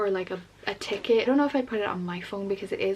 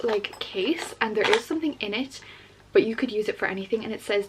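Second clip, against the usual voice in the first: ordinary talk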